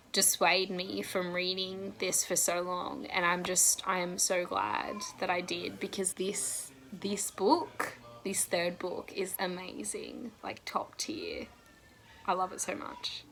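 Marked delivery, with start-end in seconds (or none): 5.4-13.2 breathless whispered gush; awed whisper about the book. Real, not acted. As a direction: hush it like a secret you can't contain.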